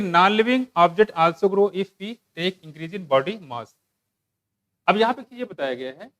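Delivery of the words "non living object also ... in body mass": slow, deliberate English reading; textbook read-aloud. Real, not acted. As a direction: read the text out slowly, phrase by phrase. (धीरे-धीरे पाठ पढ़कर सुनाते हुए)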